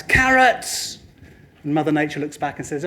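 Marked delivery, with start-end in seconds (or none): 0.0-1.0 high mock shout at the ground; farmer's shout. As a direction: holler it as a demanding farmer. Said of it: "and shouts".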